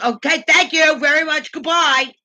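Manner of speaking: loud, high mock brush-off; imitating rude dismissal. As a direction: imitate a manager shooing off a customer. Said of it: "you don't talk like that to a customer".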